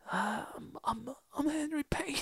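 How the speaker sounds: failed soft-spoken raspy Henry Payne. Retold or imitated. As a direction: murmur the name shyly and rough. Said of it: "soft-spoken, raspy".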